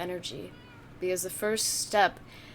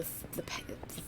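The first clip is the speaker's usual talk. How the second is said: whispering; 7 semitones lower, 12 dB quieter, slower than usual. Real, not acted. Muttering to herself.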